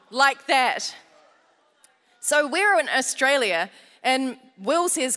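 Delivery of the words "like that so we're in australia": ordinary talk, nothing marked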